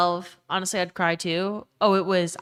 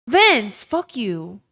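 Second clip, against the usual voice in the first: calling out; 4 semitones higher, 7 dB louder, much slower than usual. Real, not acted. Calls out a chatter by name.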